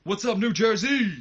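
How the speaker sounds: high, loud arena yell to the crowd; shouted crowd greeting. Retold or imitated. Shane greets the New Jersey crowd with a loud, high yell. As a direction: belt it out to the whole arena. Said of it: "He takes the mic and he says".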